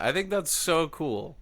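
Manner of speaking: ordinary talk, nothing marked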